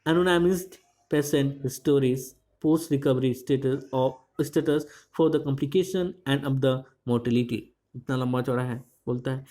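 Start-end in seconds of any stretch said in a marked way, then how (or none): 0.1-7.6 slow reading aloud. Shown as a demonstration. Reads English source text slowly.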